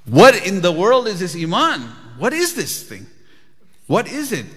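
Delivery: bowled over by the idea; lightly voiced astonished king. Astonished king questions sheikh.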